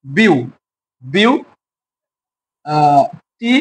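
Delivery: "বিউ" and "টি" as isolated beats with long pauses; syllable by syllable. Shown as a demonstration. Teacher demonstrating a three-part word, "বিউ", "টি" split apart. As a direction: say "বিউ" alone, wait, then "টি". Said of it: "তিন ভাগে বিভক্ত করে উচ্চারণ".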